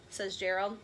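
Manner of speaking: ordinary delivery, nothing marked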